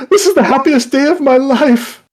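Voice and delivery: high, loud ecstatic cry. Acted. Suitor rejoicing before running out. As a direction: shout it out in pure joy.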